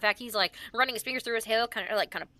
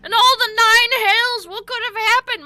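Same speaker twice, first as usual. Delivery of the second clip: outraged bafflement; 11 semitones higher, 10 dB louder than usual. Acted. Wizard bellows at the party, high and loud. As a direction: demand answers from the heavens. Wizard NPC shocked at youth.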